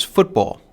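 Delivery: "football" with weight on both syllables; accent both halves of "football"; spondaic stress. Shown as a demonstration. Lecturer giving an example of a spondee, "football" accented on both syllables. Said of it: "two accented syllables".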